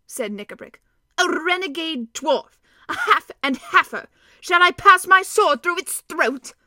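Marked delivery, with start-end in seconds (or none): 1.2-6.5 loud, higher, indignant roar; outraged. Acted. Dwarf demanding to kill the intruder, loud outraged bellow.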